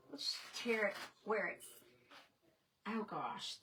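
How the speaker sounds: ordinary talk, nothing marked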